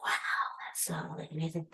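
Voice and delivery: hushed, breathy gush of wonder; mock awed whisper. Teacher mocking her own awe at a movie line, whispered. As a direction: whisper it like a revelation. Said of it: "I'm like".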